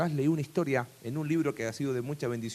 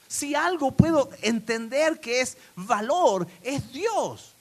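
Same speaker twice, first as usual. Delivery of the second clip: loud, fervent preaching, slowing on key words; full-force preaching; 9 semitones higher, 8 dB louder than usual. Acted. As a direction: preach it out to the whole congregation.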